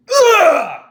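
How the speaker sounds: loud, high gag of disgust; disgusted groan. Retold or imitated. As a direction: retch at the stench. Reacting to the awful smell.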